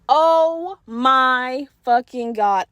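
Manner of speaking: very slow, stretched-out horrified exclamation; drawn-out dismay. Retold or imitated. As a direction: drag out each word in mock horror. Horrified realisation about the stain.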